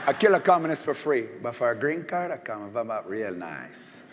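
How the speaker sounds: lightly voiced Cuban accent, slowing at the end; Tony Montana's Cuban accent, a hint of it; Scarface Cuban accent. Host imitates a Cuban gangster, mild Cuban accent. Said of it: "good accent".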